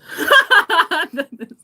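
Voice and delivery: loud, high burst of laughter; laughing. Real, not acted. Storyteller cracking up at the Walmart war, loud laughter. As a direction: crack up helplessly.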